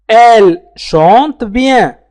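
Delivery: Elle chante bien drawn out slowly, syllable by syllable; very slow teacher demo; slow careful model. Shown as a demonstration. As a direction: spell it out slowly for learners. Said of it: "teacher models the sentence".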